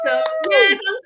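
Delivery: high, loud, celebratory cheer; whooping with joy; excited. Real, not acted. Cheers for her own comeback.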